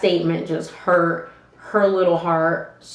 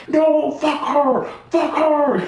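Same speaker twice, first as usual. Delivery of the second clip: higher, drawn-out hyped-up protest; retold yelling; 7 semitones higher, slower than usual. Refusing to calm down after the fight, hyped-up mock yell. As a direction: act out hollering while being held back. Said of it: "I'm yelling, I'm all hyped up".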